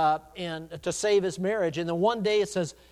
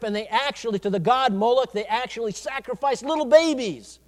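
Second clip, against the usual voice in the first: raised, emphatic preacher's delivery; forceful hellfire preaching; 3 semitones higher, 6 dB louder than usual. Acted. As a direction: press the warning home, punching key words. Preaches on hell.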